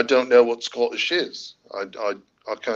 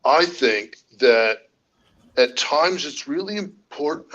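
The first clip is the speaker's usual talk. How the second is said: halting, louder imitation with swooping pitch; tentative mock speech, slow and raised; Landon; 4 semitones higher, 4 dB louder, slower than usual. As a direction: attempt an impression, drawn out and emphatic. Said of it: "I could imitate what Landon would say".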